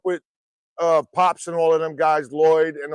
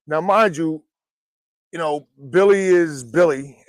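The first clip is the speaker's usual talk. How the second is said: ordinary talk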